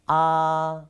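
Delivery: ah held long and level; drawn-out, even ah vowel; pronunciation demo of ah. Shown as a demonstration. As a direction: sustain ah on one steady pitch. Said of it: "about the pronunciations".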